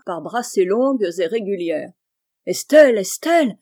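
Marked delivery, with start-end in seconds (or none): 2.5-3.0 loud call rising at the end. A narrator's reading. Husband on the jetty calls his swimming wife, loud rising call. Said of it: "criait monsieur Chabre". 3.0-3.6 loud, higher, falling firmly; calling out. A narrator's reading. Husband on the jetty calls his wife again, loud falling shout. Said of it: "criait monsieur Chabre".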